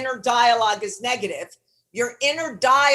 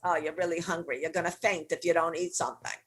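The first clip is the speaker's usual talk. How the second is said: tempting brat; 6 semitones lower, 7 dB quieter, faster than usual. Retold or imitated. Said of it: "the brat goes".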